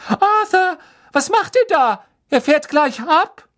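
high, loud, scolding shout; calling out urgently. A narrator's reading. Wife warning that the train leaves, urgent shout. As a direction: holler at him to hurry. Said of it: "rief Misses Weasley".

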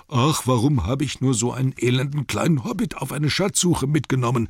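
high-pitched, put-upon dwarf complaining; Bombur. A narrator's reading. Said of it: "sagte der arme, dicke Bombur".

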